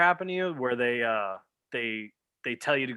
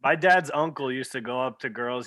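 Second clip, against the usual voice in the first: ordinary talk, nothing marked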